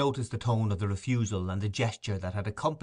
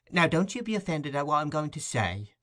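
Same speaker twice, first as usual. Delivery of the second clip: higher, sweetly coaxing; captivating sweetness; 6 semitones higher than usual. A narrator's reading. Mrs Mann sweetly coaxing the beadle, a touch higher. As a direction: charm him gently. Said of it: "with captivating sweetness".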